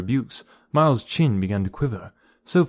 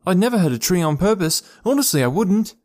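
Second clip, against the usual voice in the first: pleading; 8 semitones higher, 4 dB louder than usual. A narrator's reading. Boy pleads innocence.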